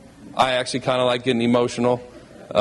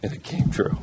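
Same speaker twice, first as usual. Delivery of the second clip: choked up. Real, not acted. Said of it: "I'm sorry I'm emotional".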